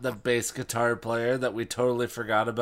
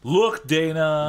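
mock snap; 5 semitones higher, 8 dB louder, slower than usual. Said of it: "Guy snapped".

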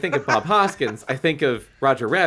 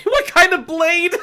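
high, slow laughing repeat; laughing disbelief; 4 dB louder than usual. Shown as a demonstration. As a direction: repeat it cracking up.

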